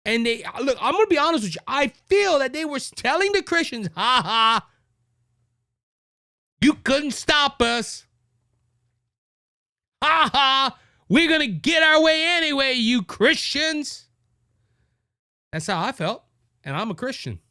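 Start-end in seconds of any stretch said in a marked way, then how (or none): 3.9-14.1 high, gloating taunt with a crowed "ha ha"; mock taunt. Voices organisers taunting the Christians.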